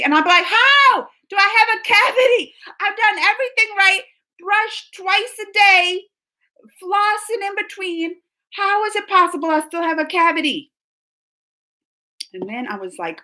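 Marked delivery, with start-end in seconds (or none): 0.4-10.7 exasperated protest. Retold or imitated. Patient protesting to the dentist about a cavity, exasperated. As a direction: act out baffled indignation, pitched high.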